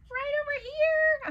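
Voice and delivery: put-on very high falsetto child voice. Shrunken child answering Waffle through the smoke in falsetto. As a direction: squeak it way up high. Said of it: "a falsetto voice spoke".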